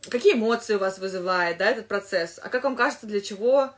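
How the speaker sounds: ordinary talk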